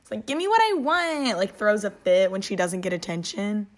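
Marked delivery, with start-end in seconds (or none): 0.3-1.4 put-on high-pitched thirteen-year-old throwing a fit; bratty teenage girl. Girl dog demanding attention, bratty teenage whine. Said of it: "teenage girl, like, thirteen, hates her mom".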